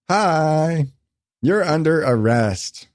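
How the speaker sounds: campy cop stripper greeting; lightly flamed-up stripper; flamboyant stripper voice. Retold or imitated.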